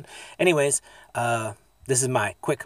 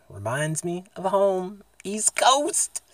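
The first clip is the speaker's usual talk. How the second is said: drawn out, climbing higher to the end; playful rising call; 8 semitones higher, much slower than usual. Pretend. Vlogger cheering his East Coast home, high drawn-out call. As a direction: stretch it slowly and let the pitch soar.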